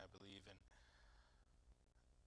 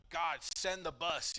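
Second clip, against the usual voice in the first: higher, louder and slow, ecstatic; hyped up; 6 semitones higher, 11 dB louder, slower than usual. Retold or imitated. Newly saved teen to God, hyped up. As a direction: shout it like nothing can touch you.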